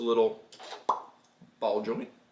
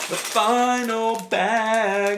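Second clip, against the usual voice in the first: 1 dramatic announcer; 10 semitones higher, 5 dB louder, much slower than usual. Acted.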